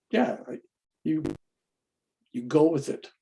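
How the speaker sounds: ordinary talk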